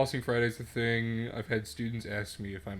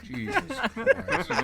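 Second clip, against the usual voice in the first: shocked; 4 dB louder, much slower than usual. Retold or imitated. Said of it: "And I'm like".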